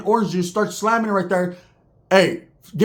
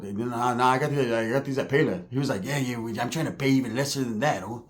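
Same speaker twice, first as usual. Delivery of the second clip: much softer, nervous; hesitant mumble; 6 semitones lower, 7 dB quieter than usual. Retold or imitated. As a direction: mumble it so he can't take them. Nervous answers and Jaws reply.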